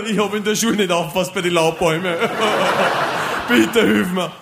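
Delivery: high, laughing call for help; laughing plea. Acted. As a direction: beg for help through laughter. Hilfloser Pilot, lachend. (lachend flehend)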